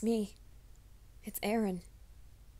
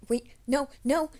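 alarmed outburst; 7 semitones higher, 11 dB louder than usual. Acted. Startled by sudden encounter.

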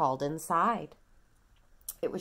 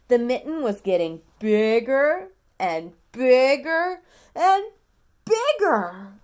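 building excitement; 3 semitones higher, 11 dB louder than usual. A narrator's reading. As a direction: swell the voice as the mitten grows. Story read to toddler.

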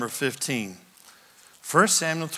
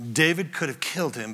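ordinary talk, nothing marked

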